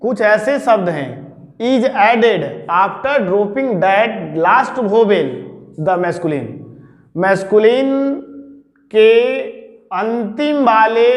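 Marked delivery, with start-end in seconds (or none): 1.6-6.5 slow dictation. Shown as a demonstration. Teacher dictating rule three.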